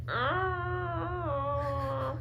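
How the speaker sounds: a mock cat growl, a little strained; cat growl again. Telling her sister how the cat growled, mock growl. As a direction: growl like a cat, almost crying it out. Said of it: "He's like".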